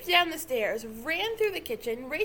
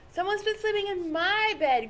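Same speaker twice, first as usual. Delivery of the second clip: louder, higher growled line; growling Papa Bear; 8 semitones higher, 6 dB louder than usual. A narrator's reading. Papa Bear finds his bed used, growling. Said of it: "growled Papa Bear".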